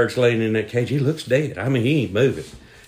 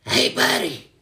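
strained, higher parrot voice; beaten-up parrot calling out; battered parrot; 5 semitones higher than usual. Acted. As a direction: croak it like a bruised bird. Battered parrot calls out weakly.

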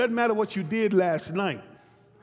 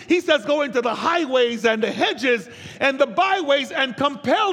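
higher, driving sermon delivery with punched key words; full-force preaching; 6 semitones higher, 4 dB louder than usual. Real, not acted. Preacher telling the congregation the kingdom is for everybody, impassioned preaching. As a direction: preach it out in rolling, insistent repetitions.

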